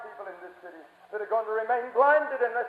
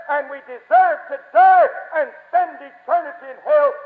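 loud, high hellfire warning; full-force preaching; 4 semitones higher, 9 dB louder than usual. Real, not acted. Preacher warns sinners of hell, thundering.

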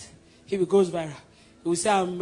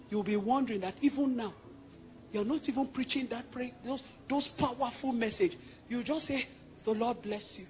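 much softer, almost under breath; hushed; 12 dB quieter than usual. Real, not acted. Preacher tells congregation of miracles, softly hushed. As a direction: pull them in with a hush.

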